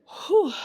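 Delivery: high, puffed exhale; out-of-breath whew. Real, not acted. Speaker pausing because she is so full, winded whew. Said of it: "I'm so out of breath".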